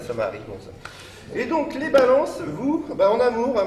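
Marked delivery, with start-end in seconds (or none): 1.4-3.7 higher-pitched, put-on Italian accent. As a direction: lift it into a mock Italian lilt. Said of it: "je perds mon accent italien". (accent italien forcé)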